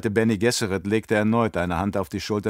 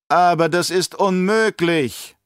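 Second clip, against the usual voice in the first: higher, slower vehement objection; heated protest; 9 semitones higher, 5 dB louder, slower than usual. Acted. Baron protests hotly to the emperor, raised and vehement. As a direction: protest hotly, drawing out each word. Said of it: "protestierte der Baron heftig".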